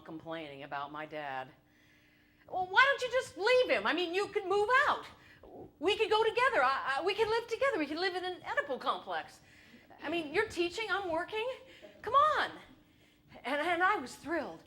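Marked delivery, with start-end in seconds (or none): 2.5-12.5 higher, eager urging; eager pleading. Retold or imitated. Son urging his mother to leave his father, eager. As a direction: sell the idea excitedly.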